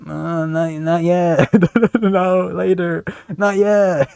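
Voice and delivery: lazy mumbling stoner, lightly done; a hint of a stoner. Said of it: "with a stoner voice saying".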